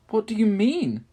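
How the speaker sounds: loud high alarmed cry; cried out. A narrator's reading. Lucy crying out on learning the truth. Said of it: "cried Lucy".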